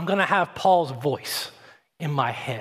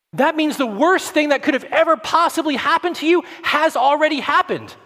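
preaching climax; 9 semitones higher, 4 dB louder than usual. Real, not acted.

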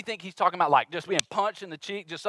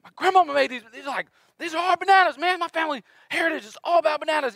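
high, pleading outrage over his bananas; offended banana seller; 10 semitones higher than usual. Retold or imitated. Vendor insulted by customer, protests in high outraged voice. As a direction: protest like a proud vendor defending family fruit. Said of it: "He's like".

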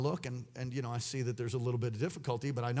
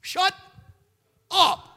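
loud, high "shut up" to the enemy; forceful rebuke yelled; rebuking shout; 9 dB louder than usual. Retold or imitated. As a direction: shout down the accusation. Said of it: "I just say".

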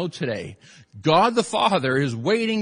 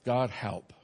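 quiet prayer; 6 semitones lower, 5 dB quieter, slower than usual. Shown as a demonstration. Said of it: "Would you say that with me?".

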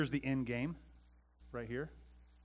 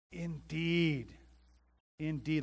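"Indeed" drawn out and higher; emphatic "Indeed"; 5 semitones higher, 3 dB louder, much slower than usual. Acted. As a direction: declare "Indeed" with certainty.

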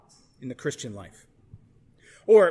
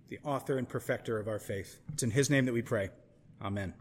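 lower, hushed prayer voice; opening prayer; 4 semitones lower than usual. Acted. Pastor leads opening prayer.

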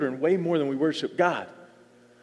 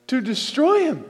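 To destroy him again, pitched higher with destroy falling hard; raised insistent repeat; higher urgent repeat; 7 semitones higher than usual. Shown as a demonstration. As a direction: lift the pitch and drive destroy down.

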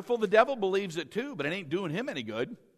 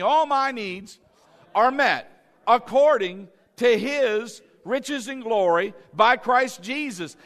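loud slow declaration; declared with congregation; 2 semitones higher, 6 dB louder, slower than usual. Shown as a demonstration. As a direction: proclaim it for all to repeat. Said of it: "Say it with me".

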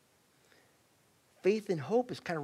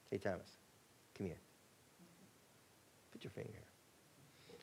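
hushed, whispered invitation; Jesus beckoning Thomas, whispered; gentle whisper; 6 semitones lower, 17 dB quieter than usual. Acted. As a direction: draw Thomas close softly.

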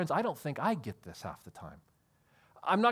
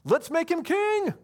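excited crowd; 8 semitones higher, 3 dB louder, slower than usual. Retold or imitated. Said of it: "and they go".

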